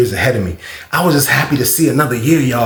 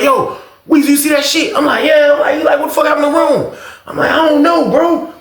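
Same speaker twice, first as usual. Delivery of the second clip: excited calling; 12 semitones higher than usual. Retold or imitated. Neighbor calling across the cells about Rome, high.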